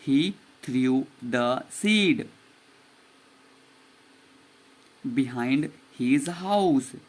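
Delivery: very slow, each word drawn out; slow model reading. Shown as a demonstration. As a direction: read it slowly for the class to repeat. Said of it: "आपको पढ़ना है मेरे पीछे पीछे". (बहुत धीरे-धीरे खींचकर पढ़ना)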